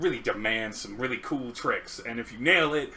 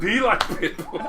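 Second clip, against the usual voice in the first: higher, louder, laughing cheer; laughing hype; 3 dB louder than usual. Acted. Hyping up singing like [name].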